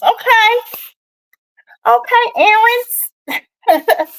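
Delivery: high, very slow, knowing "Okay, errands"; sly, sing-song "okay"; teasing okay. Real, not acted. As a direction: draw it out like you caught someone. Teasing reaction to viewer comment.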